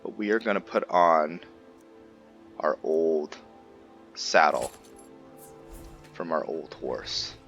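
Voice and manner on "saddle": normal talking voice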